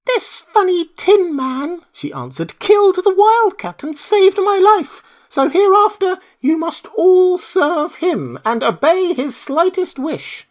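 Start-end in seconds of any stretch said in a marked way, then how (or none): 0.0-1.9 very high, slow, squeaky queen voice; mouse queen. Acted. Queen tells her mice who saved her, squeaky. 2.6-10.5 very high, slow, squeaky queen voice; mouse queen. Acted. Queen tells her mice who saved her, squeaky.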